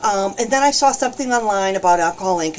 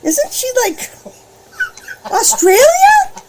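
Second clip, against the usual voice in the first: much higher, loud, amazed; surprised; 5 dB louder than usual. Real, not acted. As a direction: laugh in disbelief at how far. Surprised at a faraway viewer.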